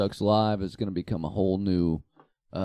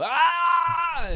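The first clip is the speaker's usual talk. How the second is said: mock yell. Customer yelling in store.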